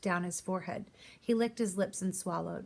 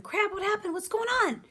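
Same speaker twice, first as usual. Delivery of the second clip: put-on high, louder panicked old man; cried out; 13 semitones higher, 3 dB louder than usual. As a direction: cry out blindly for news. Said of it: "he cried out".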